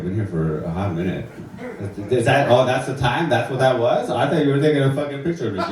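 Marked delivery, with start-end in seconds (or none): none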